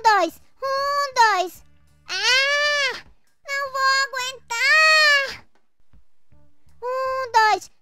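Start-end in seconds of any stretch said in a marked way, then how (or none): 2.1-5.4 out of breath. Acted. Exhausted from the exercise.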